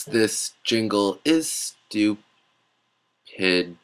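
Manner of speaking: low, slow, quiet complaint; deadpan aside. Acted. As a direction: grumble it flatly under your breath.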